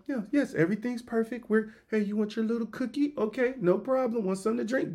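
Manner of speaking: high and soft breezy service voice; chirpy attendant smoothing everything over; cheerful flight attendant. Retold or imitated. Attendant reassuring a passenger during turbulence, high soft breezy voice.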